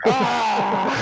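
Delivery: loud high mock war cry; mock cheer. Player joining the goblin celebration, loud mock cheer. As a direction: let out a high, hammed-up yell.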